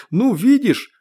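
very high, loud, pleased exclamation; delighted. A narrator's reading. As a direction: crow it to an old friend. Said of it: "обрадовался Родионов". (радостно, громко)

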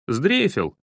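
short teasing jab; mocking. A narrator's reading. Boy dares his hesitant friend, mocking. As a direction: taunt him as a coward, lightly. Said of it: "насмешливо произнёс Денис".

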